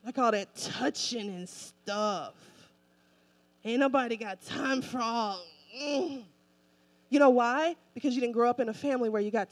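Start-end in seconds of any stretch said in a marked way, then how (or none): 0.0-6.2 low, slow, grumbling imitation of hug-shy churchgoers; mock reluctance. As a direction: recoil from all that touching, drawn out and low. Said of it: "Some of y'all like".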